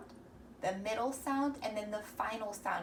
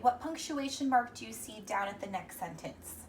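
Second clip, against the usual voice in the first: normal talking voice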